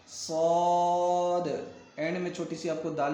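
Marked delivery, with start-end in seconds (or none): 0.1-1.5 صاد held long with rounded vowel; stretched صاد. Shown as a demonstration. Teacher models correct reading, صاد long and full. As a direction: chant صاد slowly, bouncing the final D. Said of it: "کلکلا کرنا ہے یہاں پر".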